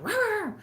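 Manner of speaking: high-pitched mock dog snarl; dog growl. The dog warning the boyfriend, a high mock snarl. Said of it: "Pac-Man be like".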